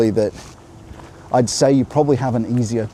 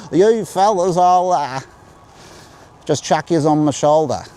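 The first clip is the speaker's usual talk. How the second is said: broad Australian accent, much higher and louder; 8 semitones higher, 6 dB louder than usual. Shown as a demonstration. Vlogger demoing the old broad Australian accent for viewers. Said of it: "That's called broad Australian".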